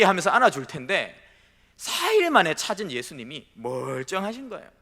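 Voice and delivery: normal talking voice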